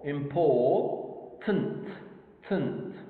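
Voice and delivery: "important" slowly, with the "tent" set apart; syllable by syllable. Shown as a demonstration. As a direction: sound out "important" in drawn-out pieces. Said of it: "The im and the por".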